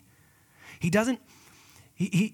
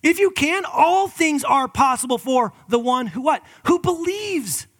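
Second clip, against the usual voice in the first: high, loud, slow proclamation; Jesus exclaiming; 10 semitones higher, 10 dB louder, slower than usual. Acted. Pastor voicing Jesus answering the father, loud and slow. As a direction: ring out each phrase to the crowd. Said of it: "And Jesus says to him".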